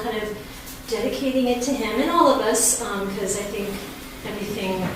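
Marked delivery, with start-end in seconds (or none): none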